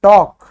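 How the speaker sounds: teacher modelling टॉक loudly for the class; model pronunciation. Shown as a demonstration. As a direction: announce टॉक firmly as "tawk". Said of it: "उसकी pronunciation अंग्रेजी में होती है ये".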